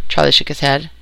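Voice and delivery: ordinary delivery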